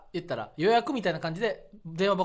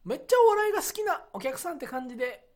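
high-pitched impression of a senior comedian; 12 semitones higher than usual. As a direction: imitate him in a much higher voice. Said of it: "森山さんが". (先輩芸人の高い声真似)

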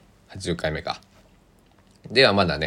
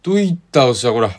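loud, hearty Tosa dialect hello, a bit higher and faster; Tosa dialect greeting; 7 semitones higher, 7 dB louder than usual. Retold or imitated. Greeting an old friend who drops by, loud hearty Tosa dialect. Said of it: "みたいなね".

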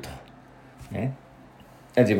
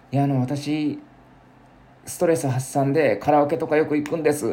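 lightly put-on, higher-pitched karaoke fan; mock someone proudly sharing a hobby, pitched up; imitated karaoke fan; 6 semitones higher than usual. Someone telling of karaoke as stress relief, lightly imitated. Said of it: "こと言う人いるじゃないですか".

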